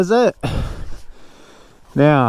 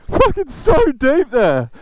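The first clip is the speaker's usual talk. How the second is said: laughing; 10 semitones higher, slower than usual. Real, not acted. Laughing at deep sand.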